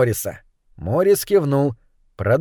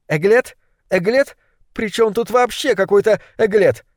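higher-pitched, exasperated repeated question; snappish; 7 semitones higher than usual. A narrator's reading. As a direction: fire back the odd word in irritated disbelief. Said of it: "рявкнула злокознее". (раздражённо рявкает, повышая тон)

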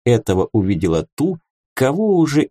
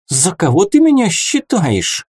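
indignant; 9 semitones higher, 3 dB louder than usual. A narrator's reading. Offended fairy.

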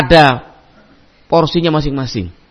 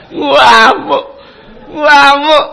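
laughing; 8 semitones higher, much slower than usual. Real, not acted. Telling of an angry outburst.

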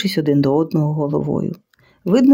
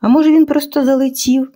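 raised, lightly voiced guard asking; curious question; 8 semitones higher, 3 dB louder than usual. A narrator's reading. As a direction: ask it with honest curiosity. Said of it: "З доброї і щирої цікавості спитав Квасило".

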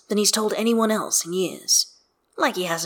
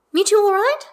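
high, loud character voice; chirpy friend; 7 dB louder than usual. Acted. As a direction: pipe up brightly as you butt in. Isabel skids up and butts in.

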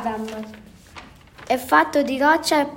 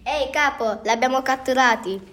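ordinary delivery, nothing marked

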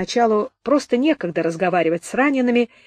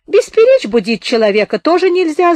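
high, louder grumble from afar; sleepy voice; 8 semitones higher, 7 dB louder than usual. A narrator's reading. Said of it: "заметил из глубины вагона сонный голос". (сонный недовольный голос, повыше)

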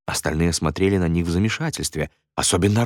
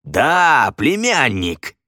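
higher, lively confirmation; emphatic confirmation; 4 semitones higher, much slower than usual. Acted. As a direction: exclaim it heartily. Иван подтверждает родство.

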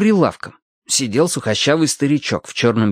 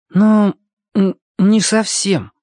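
embarrassed, higher, halting stammer; awkward; 9 semitones higher, 4 dB louder, slower than usual. A narrator's reading. Boy starts explaining to the old man, awkward. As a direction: stumble into it slowly, stuck on the first sound. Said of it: "стало ему очень неловко".

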